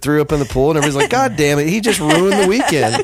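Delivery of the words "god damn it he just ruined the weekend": mock annoyed crowd. Imitating annoyed group.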